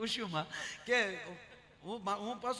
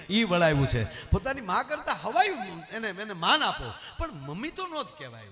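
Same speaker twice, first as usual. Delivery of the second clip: louder and louder, laughing through the sermon; loud preaching; 9 dB louder than usual. Acted. Comedian preaching to the audience about respecting the mother-in-law, loud and laughing. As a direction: drive it home to the crowd at full volume, laughing. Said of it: "preaching about mother-in-law".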